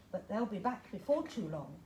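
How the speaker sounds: usual speaking voice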